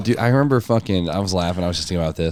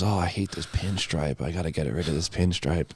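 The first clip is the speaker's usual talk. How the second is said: quiet mock grumble; 4 semitones lower, 9 dB quieter than usual. Imitating friend's grumble.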